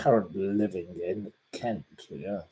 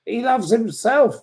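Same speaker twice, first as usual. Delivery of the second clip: gleeful mockery; 12 semitones higher, 6 dB louder than usual. Real, not acted. Mocking the troll.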